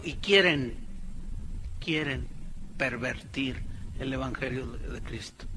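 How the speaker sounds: low, soft, with weighty pauses; hushed re-reading. Real, not acted. Preacher pointing congregation to the verb, hushed and low. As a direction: drop right down and dwell on each piece. Re-reads verse slowly, dwelling on verb.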